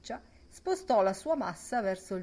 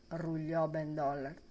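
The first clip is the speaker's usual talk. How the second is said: ordinary delivery, nothing marked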